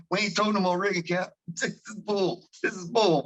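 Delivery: high, whiny crew complaint; mock deckhand griping, pitched high; complaining crew. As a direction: whine like a fed-up deckhand. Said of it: "It's like".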